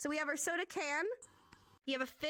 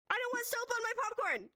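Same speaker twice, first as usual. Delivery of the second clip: playful protest; 11 semitones higher than usual. Acted. Teacher jokes about soap sign.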